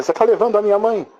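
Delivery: put-on high, quick voice of a worried little girl; acted high-pitched child's protest; child's anxious plea. Child challenges angel taking her mother.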